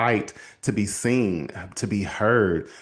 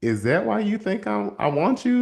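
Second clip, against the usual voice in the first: higher, gently disbelieving; incredulous question; 8 semitones higher than usual. Retold or imitated. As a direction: ask it amazed. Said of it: "he immediately looked at her and he said".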